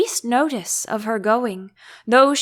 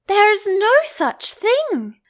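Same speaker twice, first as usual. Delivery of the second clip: slow, indignant, higher pitch; angry; 9 semitones higher, slower than usual. A narrator's reading. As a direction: protest slowly and crossly. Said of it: "Alice was beginning very angrily".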